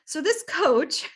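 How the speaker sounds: normal talking voice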